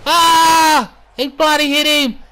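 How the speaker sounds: very high, loud commentator frenzy; frantic play-by-play shout. Acted. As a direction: scream every move as it lands. Frantic play-by-play of ladder attack.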